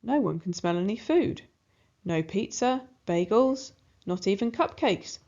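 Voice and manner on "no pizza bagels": ordinary delivery, nothing marked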